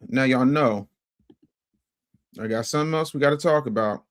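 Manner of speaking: usual speaking voice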